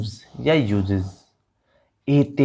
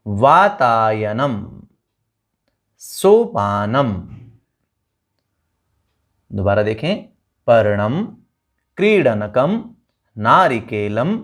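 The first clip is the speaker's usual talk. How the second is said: repeat after me; slower than usual. Shown as a demonstration. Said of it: "मेरे पीछे बोलेंगे".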